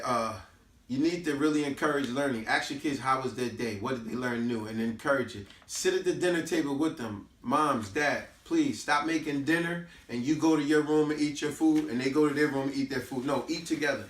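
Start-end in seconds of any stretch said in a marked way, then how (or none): none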